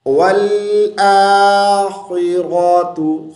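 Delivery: slow chant, heavy kh and r in आखिरतु; thick kha and ra. Shown as a demonstration. Teacher models thick letters, आखिरतु slowly chanted. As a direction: recite आखिरतु with full-mouth consonants, long aa. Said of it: "खा और रा को मोटी आवाज़ से".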